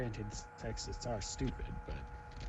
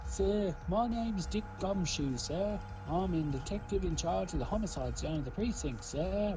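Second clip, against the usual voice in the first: high-pitched, eager British commoner detective; British commoner accent; 7 semitones higher than usual. Acted. Detective introducing himself to the court, British commoner voice. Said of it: "I used a British commoner".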